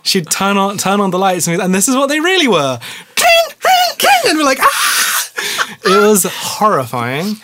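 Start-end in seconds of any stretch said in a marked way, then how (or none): 3.2-4.1 imitated click. 4.6-5.3 high breathless fake scream; mock scream. Retold fright scream.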